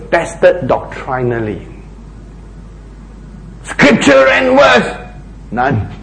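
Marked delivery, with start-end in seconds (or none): 3.6-5.1 high, slow, sarcastic demand for proof; sarcastic, raised and slowed; mock demand. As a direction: drag it out high, poking fun.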